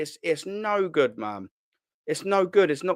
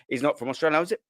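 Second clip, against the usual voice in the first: ordinary talk, nothing marked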